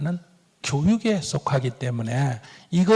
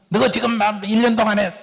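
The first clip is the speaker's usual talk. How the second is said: a higher, louder mock outburst from a sulking father; a parent's pent-up grudge finally bursting out, in a higher, louder voice; 6 semitones higher, 4 dB louder than usual. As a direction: let the grudge burst out, half-acted. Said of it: "확 튀어나온단 말이에요".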